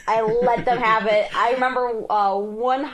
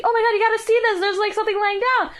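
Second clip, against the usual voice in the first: frightened excitement; 10 semitones higher, much faster than usual. Retold or imitated. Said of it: "She's like".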